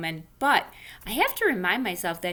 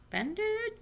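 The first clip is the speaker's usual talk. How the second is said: questioning; 6 dB quieter than usual. Shown as a demonstration. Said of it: "questions the wrong word".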